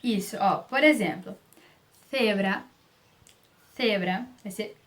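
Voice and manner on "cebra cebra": "cebra" with English th for the C; tongue between the teeth to start "cebra"; Spain-style "thebra" for "cebra"; lisped C. Shown as a demonstration. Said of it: "como S de língua presa".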